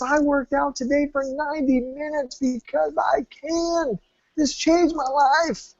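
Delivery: proud and overjoyed, savour the ending; excited client. Retold or imitated. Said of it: "he was so loud and boisterous and excited".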